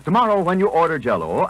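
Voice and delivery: ordinary delivery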